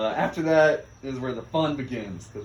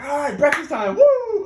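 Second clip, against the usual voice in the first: high, loud whooping excitement; excited cheer; 9 dB louder than usual. Retold or imitated. Motivated recruit hailing breakfast on initiation day, excited cheer. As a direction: burst out eagerly, rising into a whoop. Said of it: "I was so excited to go through this".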